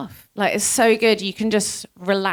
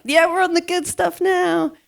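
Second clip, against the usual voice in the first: gleeful triumph; 10 semitones higher, 4 dB louder than usual. Retold or imitated. Rider retells overtaking her ex off-road, gleeful triumph. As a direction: crow with glee, pitched right up.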